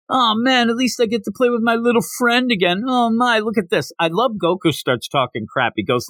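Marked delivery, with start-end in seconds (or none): 0.1-3.9 taunting bully. Retold or imitated. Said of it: "keeps making fun of him".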